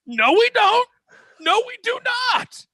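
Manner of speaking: high, loud desperate yell of no; pleading shout. Retold or imitated. To the cops at Vince's door, a high panicked shout.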